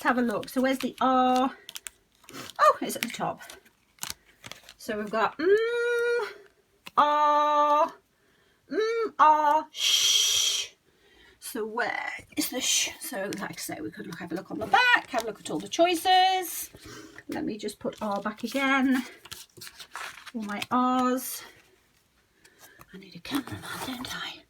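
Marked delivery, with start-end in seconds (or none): 4.8-10.7 mm, R, sh said as separate drawn-out sounds; slow phoneme-by-phoneme sounding out. Shown as a demonstration. 22.9-24.4 hushed throwaway comment; half to yourself, whispered; whispered aside. Real, not acted. Joking aside while sorting cards.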